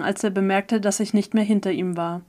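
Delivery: plain reading voice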